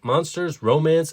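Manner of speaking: plain reading voice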